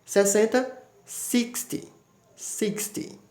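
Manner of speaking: sixty ending on a quick clipped ee; short ty sound. Shown as a demonstration. Teacher models the short ty ending for students. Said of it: "Observe aí, ó".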